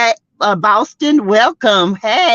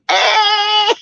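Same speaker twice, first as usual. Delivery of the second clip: high sudden hey; excited holler; 11 semitones higher than usual. Real, not acted. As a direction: whoop it out. Exclaims after taunt.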